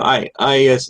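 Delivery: ordinary talk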